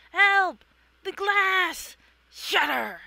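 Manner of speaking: loud, high desperate cries; panicked shout. Acted. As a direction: scream for help as glass breaks. Trapped figure cries out.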